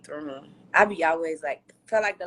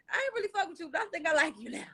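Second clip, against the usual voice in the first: put-on high girly fan voice; 11 semitones higher, 4 dB quieter, much faster than usual. Girls quoted in mentions.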